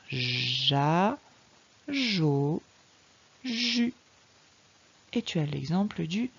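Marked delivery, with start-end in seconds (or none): 0.1-3.9 "ja", "jo", "ju" each held separately with a long zh; slow phonics demo of zh in "ja", "jo", "ju"; soft G syllables. Shown as a demonstration. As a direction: sound out "ja", "jo", "ju" slowly. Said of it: "ça va faire ja".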